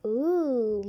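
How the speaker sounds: ordinary delivery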